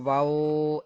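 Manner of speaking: واو with a stretched "oo" ending; common mispronunciation. Shown as a demonstration. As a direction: say واو as "vaa-oo", leaning on the tail. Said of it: "ایسے کر کے پڑھتے ہیں لوگ".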